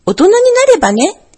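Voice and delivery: plain reading voice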